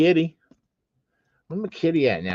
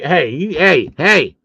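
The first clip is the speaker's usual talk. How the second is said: calling out; 7 dB louder, slower than usual. Pretend. Calling out the chat.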